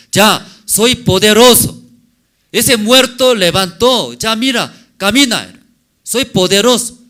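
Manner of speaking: loud, high, triumphant proclamation; Jesus proclaiming. Acted. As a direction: announce your power to the crowd. (Jesús proclama su poder a gritos)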